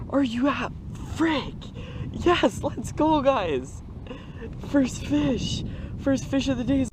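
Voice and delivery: high-pitched, thrilled outburst; elated. Real, not acted. Angler telling viewers about his first fish, elated. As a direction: celebrate a huge catch, voice way up. Just netted a huge smallmouth.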